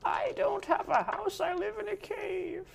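put-on high, slow old woman; a frail granny, drawn out; old lady voice. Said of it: "old lady voice".

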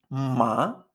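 "Ma" swept upward at the end; "Ma" as if asking something puzzled; questioning rising tone. Shown as a demonstration. Teacher likening tone to a question, "Ma" rising. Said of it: "como si nos extrañáramos".

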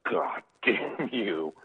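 slow, drawn-out mock curse; mock grudge. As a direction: stretch out each word in jealous spite. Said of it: "And he was mad".

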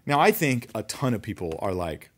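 ordinary delivery

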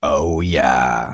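very low, altered deep voice; deep gas voice. Real, not acted. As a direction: voice dropped way down low. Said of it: "that's way better than mine".

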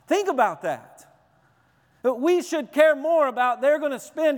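loud, high, full-force sermon climb; fiery preaching. Real, not acted. Preacher warning the congregation about hell, loud and high. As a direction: preach it hard, building to a peak.

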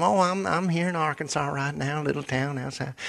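put-on high, chatty Arkansas accent; Southern agent. Arkansas agent telling a caller where they're from, Southern accent. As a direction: chat folksily in a high Southern voice. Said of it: "this incredible person from down south".

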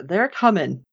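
normal talking voice

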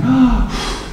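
sudden high gasp, acted out; relieved gasp. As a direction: gasp like a disciple just given good news. Acts Peter's relief on hearing news.

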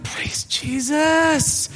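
slow, drawn-out cry of relief; relieved praise. Retold or imitated. Relieved believer celebrating the pastor idea is dropped, drawn out joyfully.

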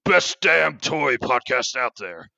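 loud, high, gruff wrestling promo. Acted. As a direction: cut a hammed-up Hulk-style promo. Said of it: "how about I cut a promo".

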